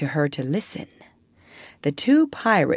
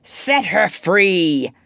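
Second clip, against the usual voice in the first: slow captain's command; Hook imitation; 3 semitones higher, 4 dB louder, much slower than usual. A narrator's reading. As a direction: draw out the order like a pirate captain. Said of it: "he now imitated the voice of Hook".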